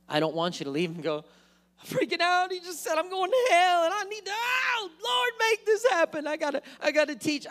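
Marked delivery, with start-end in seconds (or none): 1.8-7.5 high, frantic mock panic shout; panicked. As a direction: voice a churchgoer freaking out, high and loud. Panicked churchgoer leaving the service.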